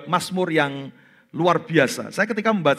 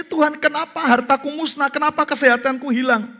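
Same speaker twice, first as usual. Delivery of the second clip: Job's anguished question; 9 semitones higher than usual. Retold or imitated. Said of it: "Dia bertanya".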